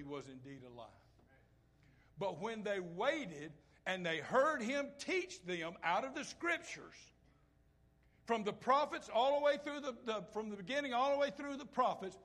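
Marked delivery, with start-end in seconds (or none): 2.2-12.2 preaching build. Real, not acted. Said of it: "preaching build, loud".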